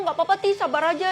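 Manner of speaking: ordinary talk, nothing marked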